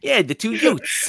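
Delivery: laughing, hammed-up "two Utes" in a Jersey drawl; a light, laughing Jersey impression of My Cousin Vinny; mock Jersey accent. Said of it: "Did you say Utes?".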